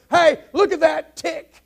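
high, loud, drawn-out punch line; acted calling out. Joking Texan points out a snapping turtle, calling out excitedly. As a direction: call it out in mock amazement.

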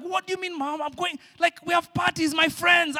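high whiny protest rising to a shout; a teenager outraged at his mom's plan; mad teen protest. Retold or imitated. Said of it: "I was so, I was so mad".

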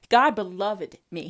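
ordinary talk

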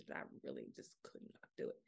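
breathy exhausted whisper; barely voiced, worn out. Real, not acted.

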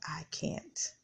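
fading to a near-whisper, holding back tears; softly breaking, missing her husband; choked-up whisper. Retold or imitated. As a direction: barely get it out, eyes welling. Grieving widow near tears.

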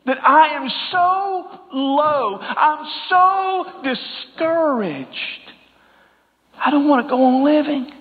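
high, slow cry of despair; preacher voicing the widow's despair, high and slow; anguished lament. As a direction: ache through each word like the widow.

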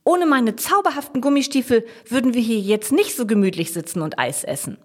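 put-on high, enthusiastic child's claim, settling at the end; gleefully prove the point; jubilant. A narrator's reading. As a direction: start loud and elated, then ease down. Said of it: "jauchzt Jasmin".

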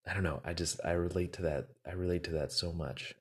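ordinary talk

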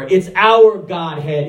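ordinary delivery

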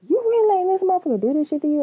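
又高又快地质问镜中的自己; 对着镜子不敢相信地快速质问; fast disbelieving self-talk. Retold or imitated. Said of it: "look in the mirror like".

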